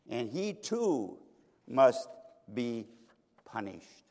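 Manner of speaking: slow, word-by-word pronouncement, dropping away; solemn sentencing. Acted. Accusers pass sentence on God, slow and solemn. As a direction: set each word apart with heavy pauses.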